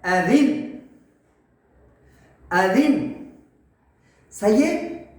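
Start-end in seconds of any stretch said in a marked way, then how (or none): 0.0-3.1 اذن closed on a vowelless nun; stopping on sakin. Shown as a demonstration. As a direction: end lower, stopping on the final ن. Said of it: "اور اس کو ساکن کر دیں گے۔".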